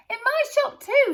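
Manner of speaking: high, loud thrilled yell. A narrator's reading. Shopkeeper yells to the crowd, thrilled and loud. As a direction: yell it out in disbelief. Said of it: "he yelled".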